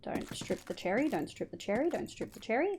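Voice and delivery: soft repeated chant to yourself; quiet little mantra while concentrating; muttered self-reminder. Real, not acted. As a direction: mutter it under your breath like a reminder.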